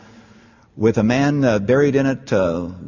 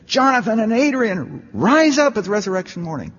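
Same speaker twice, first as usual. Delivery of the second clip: high mock call to the dead, rise up peaking loudest; calling out to the graves, half-mocking; pitched-up shout at a tombstone; retold shout; 11 semitones higher than usual. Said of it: "I went over there and I shouted".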